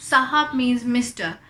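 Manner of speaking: ordinary talk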